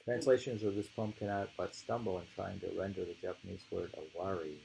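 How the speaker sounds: ordinary talk, nothing marked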